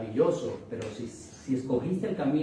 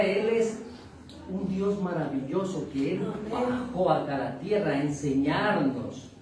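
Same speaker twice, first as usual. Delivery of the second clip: ordinary talk, nothing marked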